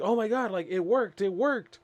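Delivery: high-pitched mock astonishment; amazed excitement. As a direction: exclaim it like a thrilled kid, voice way up. Character amazed the magic worked.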